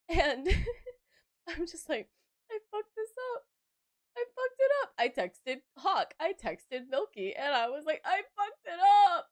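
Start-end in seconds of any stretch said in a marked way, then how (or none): 2.5-4.9 high-pitched mock whimper; hammed-up tearful despair; imitated crying. 8.1-9.3 high, loud mock wail; retold wail of regret; despairing yell. Acts out despairing message to friends.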